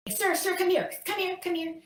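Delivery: high-pitched lady calling after someone; urgent woman calling. Retold or imitated. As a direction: summon him urgently, voice way up. Lady runs after escaped resident.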